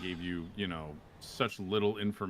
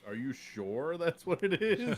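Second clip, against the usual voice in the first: teasing doubt; 8 semitones higher, 4 dB louder than usual. Retold or imitated. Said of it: "just testing you".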